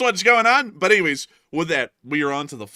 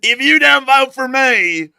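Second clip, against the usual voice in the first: loud, higher, slow hammed-up menace; mock threat; 7 semitones higher, 10 dB louder than usual. Candidate threatens Southern voters, loud slow mock threat. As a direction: drag out the threat like a crazed candidate. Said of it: "you come down into the South".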